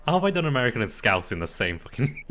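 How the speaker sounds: loud, high, fast and laughing; laughing outburst at yourself; laughing disbelief. Real, not acted. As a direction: crack up at your own botched accent. Laughing at own muddled accent.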